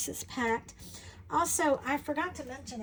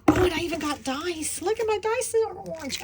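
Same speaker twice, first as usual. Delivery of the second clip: high, loud delighted surprise; excited; 6 semitones higher, 6 dB louder than usual. Real, not acted. Finding bonus dice, high excited surprise. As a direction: squeal over the dice.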